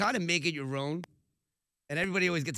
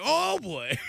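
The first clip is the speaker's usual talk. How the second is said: high, loud mock dismay; caught out; 10 semitones higher, 7 dB louder than usual. Man caught by a cop below, mock dismayed yelp. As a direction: blurt it like you've just been busted.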